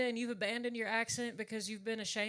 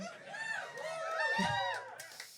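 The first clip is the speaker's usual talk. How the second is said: high shrieking laugh. Real, not acted. Big laugh.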